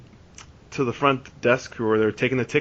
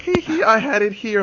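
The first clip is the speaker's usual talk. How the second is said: put-on high, silly giggling drunk friend; drunk giggle; 10 semitones higher than usual. Drunk friend admits ticket was in his pocket, giggling. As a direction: tease it out through tipsy giggles. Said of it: "smiling and frickin' giggling".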